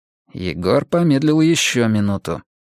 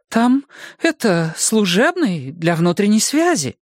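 flustered nurse; 9 semitones higher than usual. Acted.

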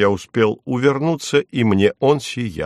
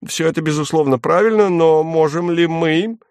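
babbling; 6 semitones higher than usual. Acted. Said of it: "залепетал Фарфуркис".

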